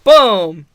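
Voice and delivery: shouted booming chair hits; loud mock crash noises; loud chair impact. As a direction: bark the impact sounds out loud. Imitating chair shot.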